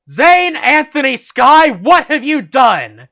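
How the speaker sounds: loud, high, slow scolding yell; father's yell. A narrator's reading. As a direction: bellow the full name like an outraged parent. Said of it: "I heard my father yell".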